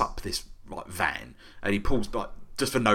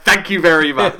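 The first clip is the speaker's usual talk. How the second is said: laughing; 7 semitones higher, 9 dB louder than usual. Retold or imitated. Clapping, thanking the man.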